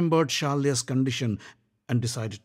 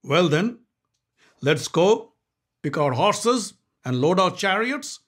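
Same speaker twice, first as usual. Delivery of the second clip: higher, louder rallying king; Shalya's voice; 7 semitones higher, 5 dB louder than usual. Acted. Shalya urging everyone to the war, rallying. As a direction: rouse them for war.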